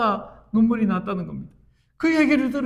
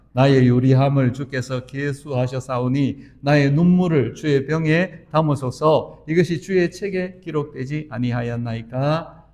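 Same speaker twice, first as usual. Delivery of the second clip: scripture reading; 5 semitones lower than usual. Acted. Reads Psalm 56:8 aloud.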